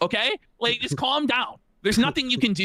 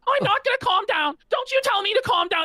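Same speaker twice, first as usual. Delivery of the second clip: high, shrill-pitched mocking falsetto; mock woman in a fight; 14 semitones higher than usual. Mocking Rich by squealing his rant in a high woman's voice. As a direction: camp it up like a woman mid-argument. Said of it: "He sounds like a woman".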